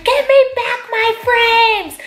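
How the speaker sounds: high, loud, slow shout; retold yell at thief. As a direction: demand it back across the mall. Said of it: "And I'm screaming".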